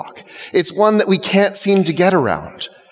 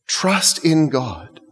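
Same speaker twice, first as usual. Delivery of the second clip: slow gentle urging; 3 dB quieter, much slower than usual. Retold or imitated. Jesus quoted, repeating slowly.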